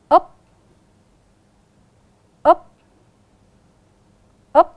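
loud model of úp with rising tone; clear úp. Shown as a demonstration. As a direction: model úp crisply for the class. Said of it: "Các con cùng đọc". (đọc mẫu vần úp rõ ràng)

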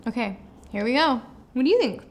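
chewing while talking; biting fruit strip between words; mouth partly full. Real, not acted. Seen 0: Holds strip, bites it at 2.4–2.7, chewing between words.

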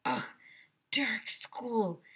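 disgusted whisper. Acted. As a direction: keep it secret. Ryan continues whispering.

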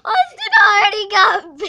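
loud laughing shout; excited shout. Real, not acted. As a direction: yell it out gleefully.

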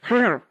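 Gryphon exclamation. A narrator's reading. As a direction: burst out with a creature's growly trill. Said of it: "an occasional exclamation of".

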